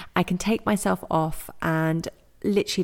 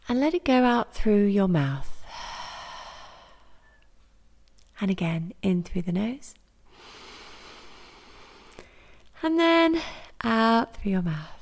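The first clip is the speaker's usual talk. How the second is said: guided meditation. Acted. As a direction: lead them gently through each breath, unhurried. Leads a yoga nidra.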